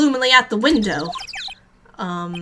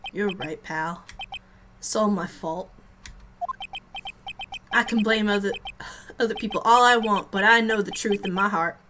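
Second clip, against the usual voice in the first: put-on Southern accent; 4 semitones lower, 3 dB quieter than usual. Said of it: "He's Southern again!".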